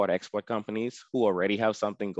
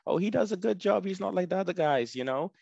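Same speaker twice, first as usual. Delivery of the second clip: quoting a customer; 9 semitones higher than usual. Retold or imitated. Customer quoted praising him.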